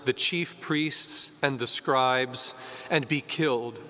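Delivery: ordinary talk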